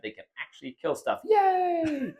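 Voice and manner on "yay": high-pitched drawn-out yay; little cheer. Real, not acted. Player cheering his Witches finally killing stuff, high little cheer. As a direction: squeal a small, playful cheer.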